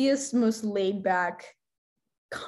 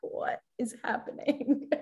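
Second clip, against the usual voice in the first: soft, slow whisper; whispered disbelief; 4 semitones higher, 6 dB quieter, slower than usual. Retold or imitated. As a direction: breathe it out in disbelief. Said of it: "And I was like".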